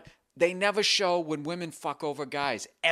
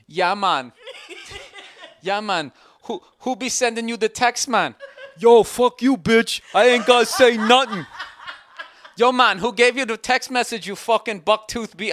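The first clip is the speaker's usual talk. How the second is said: higher, louder, laughing bad Jamaican accent; 9 semitones higher, 8 dB louder than usual. Mocking the boyfriend in a bad Jamaican accent, high and loud. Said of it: "this really bad Jamaican accent".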